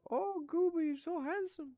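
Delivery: very high, loud falsetto coo; squeaky pet voice. Real, not acted. Telling Goober he's handsome, a very high squeaky falsetto coo. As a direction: squeal praise at the dog like a cartoon. Cooing at the dog.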